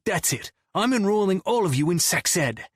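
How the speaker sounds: exasperated, high-pitched announcement; annoyed. A narrator's reading. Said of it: "Scotty makes an annoyed noise".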